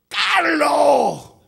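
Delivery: long, high, full-force call falling away; mother's scream. Retold or imitated. Mother calling her lost son from above, screaming. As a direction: scream the name out to someone far off. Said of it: "gritó".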